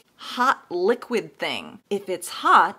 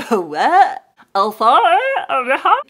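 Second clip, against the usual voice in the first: loud, slowed what then a startled sorry; first-time listener overwhelmed by the song; mock stunned reaction; 3 semitones higher, 6 dB louder, much slower than usual. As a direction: replay the shock of hearing it. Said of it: "hearing it the first time and going".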